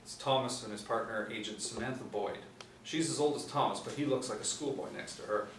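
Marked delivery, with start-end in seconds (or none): none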